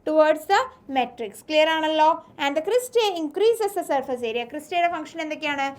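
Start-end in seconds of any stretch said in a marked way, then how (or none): 0.1-1.3 slow dictation. Shown as a demonstration. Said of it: "ഇത് എവിടേക്കാണ് form ചെയ്യുന്നത്".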